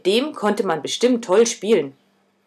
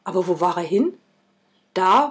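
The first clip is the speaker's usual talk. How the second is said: ordinary delivery, nothing marked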